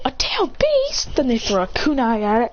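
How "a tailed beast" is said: very high, slow incredulous echo; acted astonishment, stretched and pitched way up; shocked question. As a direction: squeak it out in disbelief. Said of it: "They go".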